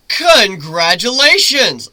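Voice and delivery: slow, loud, cheerful call-out; happy call. Acted. Griffin congratulating shaken Lyra on deck, loud happy call. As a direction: call it out with a grin. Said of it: "I called out to her happily".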